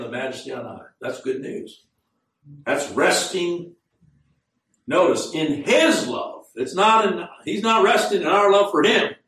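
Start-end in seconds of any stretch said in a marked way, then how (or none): 2.7-9.1 raised pitch, driving the contrast home; emphatic preaching. Real, not acted. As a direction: preach it insistently, leaning on key words.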